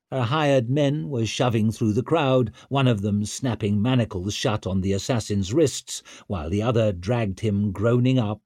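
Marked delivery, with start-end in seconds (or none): none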